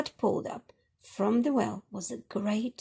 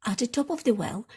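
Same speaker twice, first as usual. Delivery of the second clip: ordinary delivery, nothing marked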